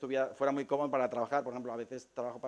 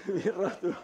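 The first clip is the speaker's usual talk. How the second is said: laughing; 7 semitones higher, 5 dB louder than usual. Retold or imitated. Laughing while retelling the sign moment.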